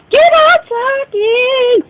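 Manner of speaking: high, slow, sing-song. Real, not acted. Mocking herself for rambling on, a high slow sing-song.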